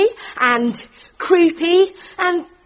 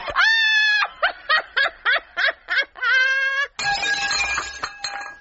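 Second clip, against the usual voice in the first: long, very high cackle; hysterical laughter; 5 dB quieter than usual. Acted. Debbie laughing at Jenkins and Lucretia's date, prolonged hysterics. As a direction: let the laughing fit run on and on.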